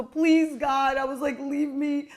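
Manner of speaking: high, loud begging to God; exasperated plea. Acted. Driver pleading to God after a fight, exasperated and loud. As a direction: beg loudly, at the end of your rope. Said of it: "I went like this".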